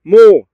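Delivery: "مو" said as one loud, sounded-out syllable mu; the syllable "مو" spoken alone as mu, loud and drawn clear. Shown as a demonstration. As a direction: sound out "مو" loudly for the class, like a teacher. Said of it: "كيف تنطق؟".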